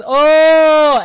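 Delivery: long, high, loud "oh"; drawn-out realisation. Retold or imitated. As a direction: stretch out a big oh of dawning surprise. Acting out her stunned realisation.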